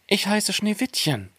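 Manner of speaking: put-on high girlish character voice. A narrator's reading. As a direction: answer high, without pushing. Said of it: "antwortete es".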